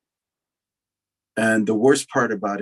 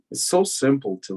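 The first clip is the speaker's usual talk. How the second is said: normal talking voice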